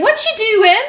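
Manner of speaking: very high mock Irish accent; child's Irish accent. Mum mimics her five-year-old, high pretend Irish accent. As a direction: squeak it like a little girl pretending to be Irish. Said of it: "And she's like".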